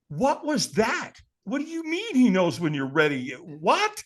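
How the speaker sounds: high-pitched, incredulous questioning; baffled disbelief. Retold or imitated. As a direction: act out being totally thrown. Acts out his baffled reaction.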